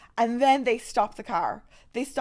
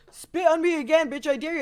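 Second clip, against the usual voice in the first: cocky taunt; 5 semitones higher than usual. Retold or imitated. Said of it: "trying to act high and mighty".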